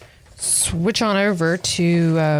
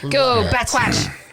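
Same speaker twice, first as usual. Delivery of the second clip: high, drawn-out rallying cry; mock cheer; 5 semitones higher, much slower than usual. Co-host rooting for Bat Squatch, mock cheer. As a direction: cheer on the monster slowly, pitched up.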